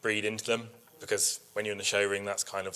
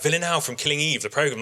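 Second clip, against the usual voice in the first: higher, louder, thrilled onlooker; excited recognition; 6 semitones higher, 4 dB louder than usual. Retold or imitated. Onlooker delighted by the sheep's name, excited. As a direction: gasp it like a fan who got the reference.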